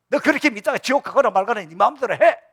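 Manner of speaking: loud, high scolding at the son; angry outburst. Retold or imitated. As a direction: snap it out in exasperation. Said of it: "이러면 안 됩니다". (화난 목소리로 크게 쏘아붙이기)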